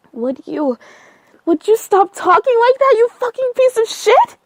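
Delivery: high, loud screaming insult; woman screaming at her tormentor; furious scream. Acted. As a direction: scream it at him in rage. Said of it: "she screamed".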